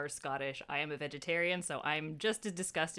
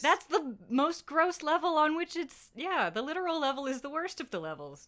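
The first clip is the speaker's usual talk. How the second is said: disgusted; 10 semitones higher, 4 dB louder than usual. Real, not acted. Still reacting to haggis joke.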